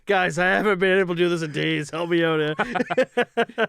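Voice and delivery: high, loud mock begging; mock plea. Injured player asks teammates for help, mock pleading. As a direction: plead loudly with your buddies, half joking.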